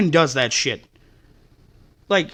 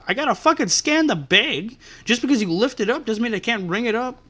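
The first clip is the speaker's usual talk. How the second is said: ordinary delivery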